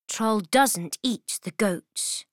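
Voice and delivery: ordinary delivery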